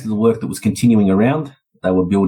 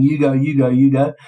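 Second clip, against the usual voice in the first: put-on lightly childish squabble, higher pitched; a hint of kids egging each other on; like little children; 5 semitones higher than usual. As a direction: imitate two men bickering like kids. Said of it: "sat there like little children".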